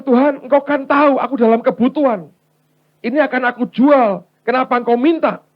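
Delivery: high, loud acted plea; pleading protest. Wife in need questioning God's request, high-pitched protest.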